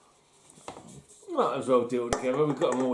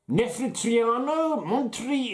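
mock Slovenian accent; 7 semitones higher, slower than usual. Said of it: "let's put on my best Slovenian accent".

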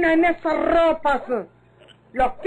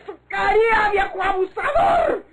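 grito rabioso entre sollozos; le grita el insulto con furia; grito furioso; 3 semitones higher than usual. Acted. Victoria golpea al viejo. (Victoria insulta a gritos al viejo abusador)

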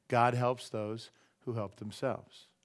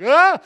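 sudden high, loud shriek of fright; startled shriek; 18 dB louder than usual. Retold or imitated. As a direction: shriek as if something dives at you. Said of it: "And Mariah's like".

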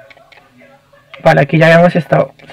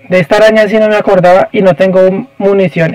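normal talking voice

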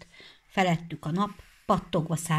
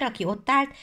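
ordinary delivery

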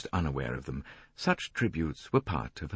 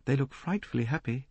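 envious; 7 semitones higher, 4 dB quieter than usual. A narrator's reading. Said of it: "with a note of envy in her voice".

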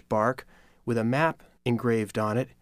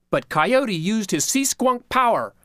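usual speaking voice

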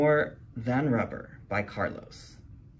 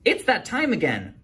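teacher announcing; 9 semitones higher, 3 dB louder than usual. Acted. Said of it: "Miss Fizzle announces".

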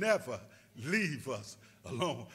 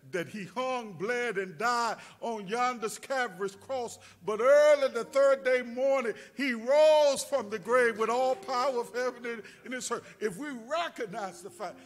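higher and louder, rising to a cry; preaching at full force; 6 semitones higher, 9 dB louder than usual. Acted. Preacher to the congregation, crying out the resurrection. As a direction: proclaim the resurrection with rising fire.

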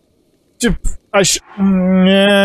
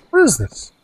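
higher curious question; 4 semitones higher than usual. Real, not acted. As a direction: question starting high and dropping sharply. Same question repeated while exploring.

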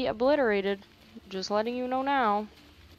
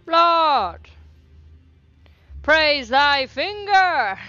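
hammed-up holy praise, slow and louder; a gamer hailing a loot drop like a mock preacher; mock worship; 6 semitones higher, 5 dB louder, much slower than usual. Player praising a finger item, mock-reverent.

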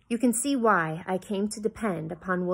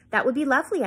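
surprised but pleased; 6 semitones higher, 5 dB louder, faster than usual. A narrator's reading. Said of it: "surprised but pleased".

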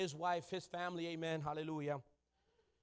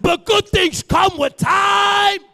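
shouted preaching, climbing higher; preaching at full force; 11 semitones higher, 24 dB louder, much slower than usual. Acted. Preacher booming God's words at the congregation, shouting. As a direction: thunder God's promise to the church.

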